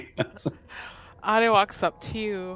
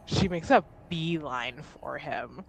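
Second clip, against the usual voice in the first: normal talking voice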